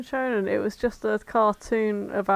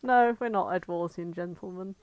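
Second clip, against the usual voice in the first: usual speaking voice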